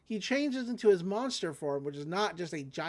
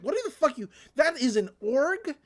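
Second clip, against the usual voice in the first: angry boss rant; 5 semitones higher, 3 dB louder than usual. Retold or imitated. Angry boss yelling at rangers.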